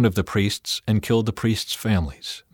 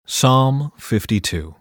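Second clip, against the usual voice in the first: ordinary delivery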